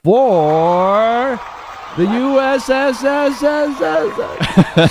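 hissing S stretched out, high and loud; drawn-out s like a snake. Acted. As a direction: stretch the S into a long hiss. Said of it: "I had to do my own then".

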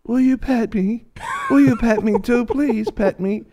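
high, drawn-out pleading pup voice; little dog voice. Acted. Small dog begging a stranger to pet it, high pleading voice. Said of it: "this other little dog comes up and goes".

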